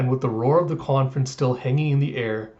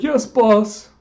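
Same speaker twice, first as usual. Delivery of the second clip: eager underling; 9 semitones higher, 6 dB louder than usual. Acted. Assistant answers Morgans' order.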